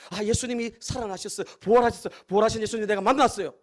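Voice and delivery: breathless-eager retelling, quickening at the end; excited announcement. Acted. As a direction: burst in with astonishing news. Voicing Mary's news of resurrection.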